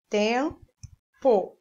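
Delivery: "tempo" split by a pause into tem, po; sounding out syllables. Shown as a demonstration. Teacher sounds out the word. ("tempo" separado em sílabas)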